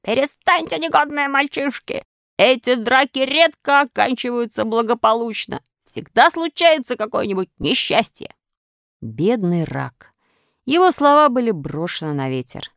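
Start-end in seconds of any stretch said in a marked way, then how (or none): 0.0-8.3 lightly voiced old crab, higher; hoarse crab's cry. A narrator's reading. Crab warning fighting boys, mild hoarse scolding. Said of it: "закричал хриплым голосом".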